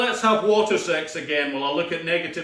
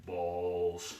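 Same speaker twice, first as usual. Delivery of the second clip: much lower, softer, dark; ominous deep; 11 semitones lower, 11 dB quieter than usual. Acted. Villain listing deadly props, ominous. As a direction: drop the word low and dark.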